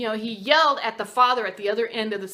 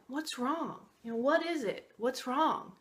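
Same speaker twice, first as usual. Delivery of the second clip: much softer, caring question; gentle concern; 4 semitones higher, 8 dB quieter than usual. Retold or imitated. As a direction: ask tenderly, like comforting a friend. Old master gently asks grieving father.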